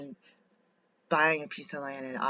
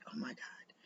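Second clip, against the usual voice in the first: hushed, unvoiced Oh my God; awed whisper; 15 dB quieter than usual. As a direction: whisper it in disbelief. Awed at auction find.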